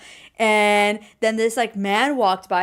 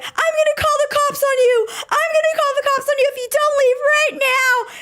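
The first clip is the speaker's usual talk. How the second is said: cashier's shrill threat; 3 dB louder than usual. Retold or imitated. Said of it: "one of the girls is like".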